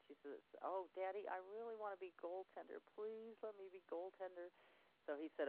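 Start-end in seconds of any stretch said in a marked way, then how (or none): 0.6-4.5 put-on high, pleading child's voice; begging little girl. Daughter pleading with her father to let her be goaltender, high child voice. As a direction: plead like a small girl who wants it badly.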